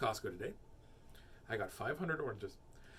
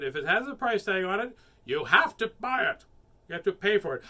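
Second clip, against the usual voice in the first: loud, hammered demand; insistent cashier; 5 semitones higher, 9 dB louder than usual. A narrator's reading. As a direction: insist loudly, pressing each point. Cashier argues with father.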